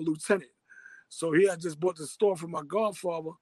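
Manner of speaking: ordinary talk, nothing marked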